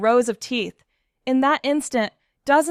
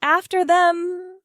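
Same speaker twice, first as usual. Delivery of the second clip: rallying shout; 7 semitones higher, 4 dB louder, slower than usual. A narrator's reading. The team chasing.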